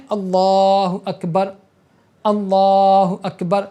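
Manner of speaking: "اللہ اکبر" said slowly with a short, proper alif; correct Allahu Akbar. Shown as a demonstration. Teacher modelling the right takbir for students: "اللہ اکبر" said slowly and cleanly. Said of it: "تم کو صرف اس طرح بولنا ہے".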